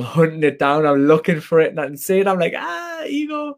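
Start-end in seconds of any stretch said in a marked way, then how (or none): 2.6-3.6 slow, very high mock discovery; high drawn-out "ah". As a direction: stretch it out up high like spotting a rare bird. Spotting ego in others.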